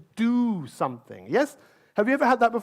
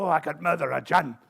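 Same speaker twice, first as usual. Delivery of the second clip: put-on Scottish accent; 6 semitones lower than usual. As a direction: lower Scottish cameraman voice. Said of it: "it's a Scottish accent".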